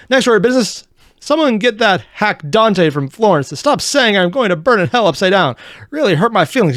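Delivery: airing petty papal grievances, hammed up; mock pope voice. Reacting to the pope video, a lightly hammed-up pompous pope. Voicing the pope's complaints.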